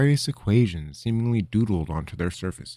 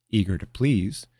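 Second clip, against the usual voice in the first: usual speaking voice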